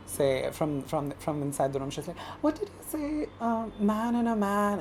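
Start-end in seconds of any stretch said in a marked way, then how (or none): 2.4-4.8 soft, high, halting puzzlement; imitating a baffled mom; confused mother. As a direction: ask it slowly and softly, lost. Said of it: "she's still confused".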